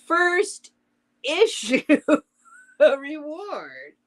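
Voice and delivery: high-pitched, cracking up mid-line; laughing while reading. Real, not acted. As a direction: try to read on through the giggles. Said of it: "I don't know why I'm laughing".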